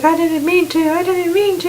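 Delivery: high-pitched panicked pleading, hammed-up; mock freaking out. Woman who just shot Omid, high mock freak-out. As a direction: imitate a frantic excuse in a high voice. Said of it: "freaks out".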